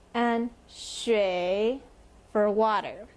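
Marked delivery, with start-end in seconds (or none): 0.7-1.8 "shui" dipping low, then rising; third tone. Shown as a demonstration. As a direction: draw "shui" down and back up. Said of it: "so down and up".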